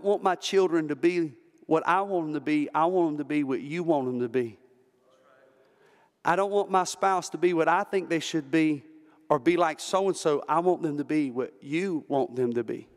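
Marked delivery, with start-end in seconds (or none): none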